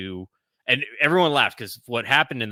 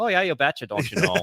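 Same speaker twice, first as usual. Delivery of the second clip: playful Upper Midwest "you betcha" impression; Minnesota accent; 9 semitones higher, 4 dB louder than usual. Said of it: "I've got that northern twang".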